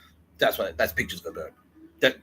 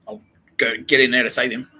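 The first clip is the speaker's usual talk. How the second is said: ordinary talk, nothing marked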